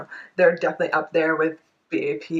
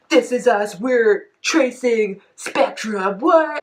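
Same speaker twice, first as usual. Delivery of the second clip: higher, louder, drawn-out parody ending in a squeak; mock dramatic lyrics; 4 semitones higher, 6 dB louder, much slower than usual. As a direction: ham up the concept, then baffled.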